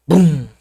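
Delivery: loud imitated cannon blast; single loud vocal bang; cannon-shot boom. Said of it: "o tiro de canhão".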